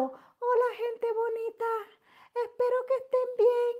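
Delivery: high, sugary mock streamer greeting; mock sweet greeting. Mocking how other streamers greet their followers, high and sugary. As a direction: parody a dainty influencer hello. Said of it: "Nunca he hablado".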